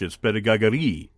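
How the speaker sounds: pédagogie with French vowels, pe-da-go-GIE; French pronunciation. Shown as a demonstration. Host showing off the French word pédagogie. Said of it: "in French".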